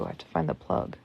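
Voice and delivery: whisper. Real, not acted. Unvoiced aside.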